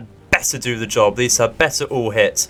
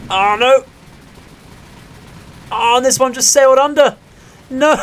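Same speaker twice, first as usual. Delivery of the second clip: much higher and louder, real dismay; dismayed cry; 13 semitones higher, 6 dB louder than usual. Real, not acted. Gamer watching torpedoes miss, dismayed outcry. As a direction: cry out as it slips under.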